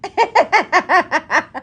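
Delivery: high burst of laughter; cracking up at the viewers; loud real laugh. Real, not acted.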